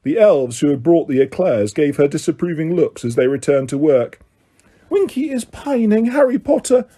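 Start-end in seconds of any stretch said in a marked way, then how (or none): 4.9-6.9 high, hushed sad elf voice; a touch of sorrow in a small elf voice; Dobby confiding sadly to Harry about Winky; sad elf whisper. A narrator's reading. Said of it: "Dobby whispered sadly".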